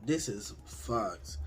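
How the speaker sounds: slow, low, defeated mutter; dejected groan. Retold or imitated. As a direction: sigh it out, beaten. Dejected after failed sex.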